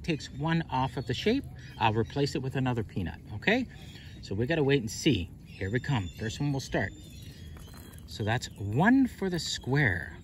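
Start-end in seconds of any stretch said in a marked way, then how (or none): none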